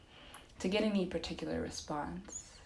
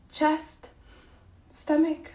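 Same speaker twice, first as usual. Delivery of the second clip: high, loud words on a full breath; holding breath; 10 semitones higher, 9 dB louder, slower than usual. Real, not acted. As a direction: squeeze the words out on held air. Talks while holding an inhaled breath.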